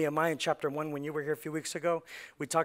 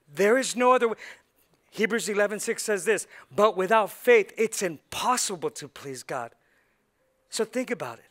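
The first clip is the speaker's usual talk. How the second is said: higher, louder sermon delivery; emphatic pulpit proclamation; forceful preaching; 5 semitones higher, 6 dB louder than usual. Real, not acted. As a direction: preach it hard, punching key phrases loudly.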